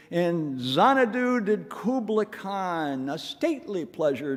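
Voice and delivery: slow, sonorous verse with drawn-out words; poem recitation. Acted. Preacher reciting favorite poem to congregation, slow and stately recitation. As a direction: recite it grandly, lingering on each line.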